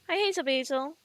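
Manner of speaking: high, loud sing-song pet talk. Real, not acted. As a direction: gush the dog's name brightly. Greeting the dog again.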